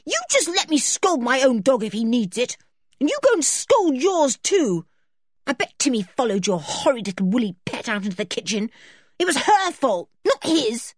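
high, heated scolding; angry snapping. A narrator's reading. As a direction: turn crossly on a friend. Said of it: "Then George turned on Berta".

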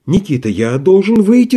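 голос дрожит, выше обычного; с дрожью в голосе просит; дрогнувший голос. Acted. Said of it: "сказал он дрогнувшим голосом". (голос дрогнул от волнения)

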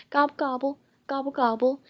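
playful turkey imitation; turkey gobble. A narrator's reading.

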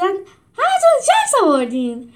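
slow, high, loud gleeful cry; gleeful shout. A narrator's reading. Trainer gleefully shouting at the new animal recruits, high and loud. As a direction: shout it out with glee. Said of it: "با خوشحالی داد زد".

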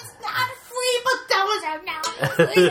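mock kid protest about a cookie; screaming kid. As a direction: whine it like a toddler refusing. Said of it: "the kid was screaming".

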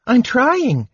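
very high, wounded little kender voice; hurt tone. A narrator's reading. Kender to scolding dwarf, hurt tone. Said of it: "replied in a hurt tone".

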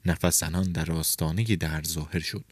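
ordinary delivery, nothing marked

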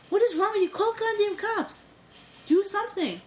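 high-pitched, exasperated demand; indignant quote, voice jumping high; retold outrage. Retold demand that staff act.

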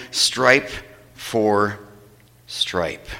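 drops low and soft, word by word; fading out. Real, not acted. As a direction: let the list die away slowly. Slow, fading end of list.

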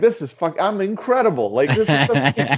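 mock-cocky laughing brag; smug self-hype. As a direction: gloat like you're untouchable. High-fiving himself, retold.

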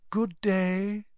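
airy, misty seer's greeting; a hint of a dreamy mystic. A narrator's reading. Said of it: "said the misty voice".

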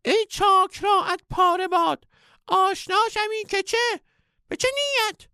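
very high, loud scolding shout; shrill comic outburst; angry squeaky sage. A narrator's reading. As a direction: rage at him in the guru voice.

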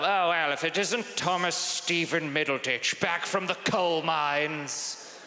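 put-on Dickensian old headmaster voice; theatrical old British schoolmaster. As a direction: greet him like an old headmaster, drawing out the ending. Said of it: "old, like, headmasters".

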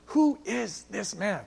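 slow, drawn-out stunned question; stunned awe. Acted. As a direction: play an amazed onlooker, softly and slowly. Disciples awed at calmed storm.

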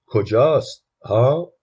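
narrated nudge for an answer; questioning. A narrator's reading. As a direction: ask it pressingly, lifting at the end. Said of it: "مرد جوان سرش را به بچه نزدیکتر کرد و پرسید".

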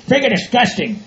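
ordinary delivery

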